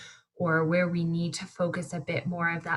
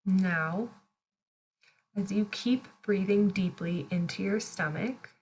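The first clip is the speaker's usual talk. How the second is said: guided meditation. Real, not acted. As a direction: guide them gently through each breath, unhurried. Leads a breathing meditation.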